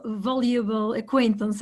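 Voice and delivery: ordinary delivery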